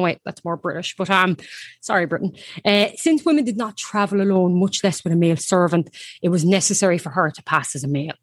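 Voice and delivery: ordinary delivery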